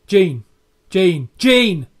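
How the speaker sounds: calling out. Retold or imitated. Crew member calling Gene, mock shout from afar. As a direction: call him from across the room. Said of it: "someone is shouting Gene's name".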